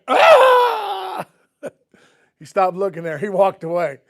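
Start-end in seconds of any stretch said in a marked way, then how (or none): 0.1-1.3 loud, high-pitched comic scream; mock shriek of shock; imitated scream. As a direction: scream like you've just been squirted in the eye. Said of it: "And that guy went".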